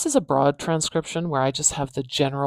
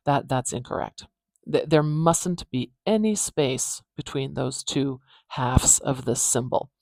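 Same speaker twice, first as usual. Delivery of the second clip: ordinary delivery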